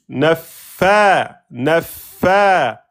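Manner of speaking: "Naffa" with held f and a loud, long final a; prolongation. Shown as a demonstration. Teacher isolating the long vowel, "Naffa" stretched. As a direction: swell into the end of "Naffa". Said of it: "prolongation ici".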